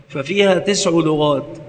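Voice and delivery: usual speaking voice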